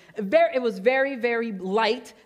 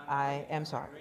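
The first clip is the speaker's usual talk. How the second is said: sincere apology; 7 semitones lower, 7 dB quieter than usual. Real, not acted. Apologizing for yelling ahead.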